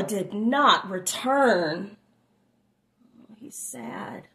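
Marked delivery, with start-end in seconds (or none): 3.4-4.3 soft whispered aside. Real, not acted. Reader whispers to child, soft and unvoiced. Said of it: "aside to child, whispered".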